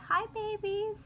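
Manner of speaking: high sweet voice for the little ones; mother voicing her son greeting the belly, high and sweet; son's baby talk. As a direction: coo it like a child greeting babies. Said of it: "gave me the biggest hug and said".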